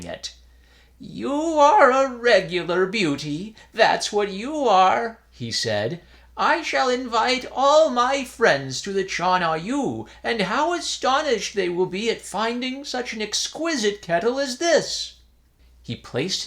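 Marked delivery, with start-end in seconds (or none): 1.0-5.2 put-on high, fond voice of a pleased old priest; old priest, delighted. A narrator's reading. Priest admires kettle aloud. 6.4-15.1 put-on high, fond voice of a pleased old priest; old priest, delighted. A narrator's reading. Priest plans proud tea party.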